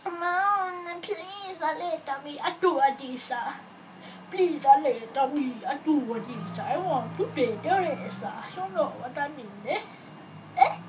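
pleading, hammed-up Italian accent. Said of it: "That's my Italian accent".

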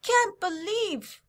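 put-on very high, slow, incredulous Eastern European old lady; loud, drawn-out mock Eastern European accent; vague Eastern European accent. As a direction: ham up an astonished neighbour's Eastern European accent. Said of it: "still don't know what kind of accent".

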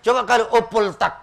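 ordinary talk

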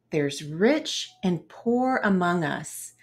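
plain reading voice

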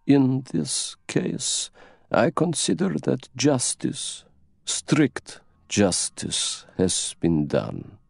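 very slow, weighty delivery; resolute old princess. Acted. Princess answering Poirot about justice, slow and resolute. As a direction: set each phrase apart with grave deliberation.

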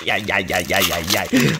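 pained groans. Real, not acted. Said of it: "je ne peux plus parler".

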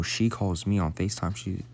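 usual speaking voice

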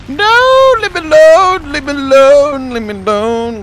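very high squeal sinking back down; high panicky plea that winds down; panicked squeal. Pretend. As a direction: beg to be left alone, shrill to calm. Panicked plea to chasing enemy.

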